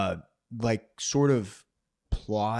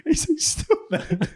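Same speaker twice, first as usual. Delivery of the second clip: laughing; much slower than usual. Real, not acted. Laughing while recalling a funny scene.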